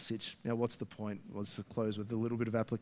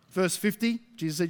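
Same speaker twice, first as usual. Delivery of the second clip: ordinary talk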